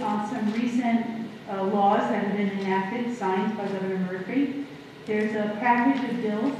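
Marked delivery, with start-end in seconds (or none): none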